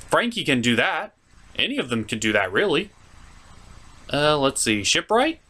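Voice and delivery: normal talking voice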